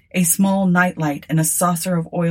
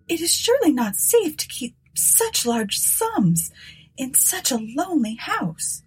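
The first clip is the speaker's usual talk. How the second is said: higher, timid younger sister voice; 8 semitones higher, 3 dB quieter than usual. A narrator's reading. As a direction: voice Eunice as a nervous woman. Eunice voiced in story.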